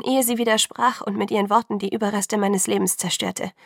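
plain reading voice

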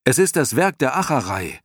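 high, loud appeal; calling out. A narrator's reading. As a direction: call it out with urgency. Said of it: "rief er". (laut und hoch rufend)

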